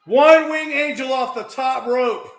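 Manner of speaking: high, loud repeated shout; shouted call. Real, not acted. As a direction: yell it out as the move lands.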